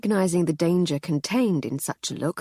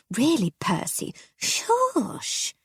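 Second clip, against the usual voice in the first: much higher, hushing wife voice; high shushing wife; 8 semitones higher, much slower than usual. Acted. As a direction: shush him, high and anxious.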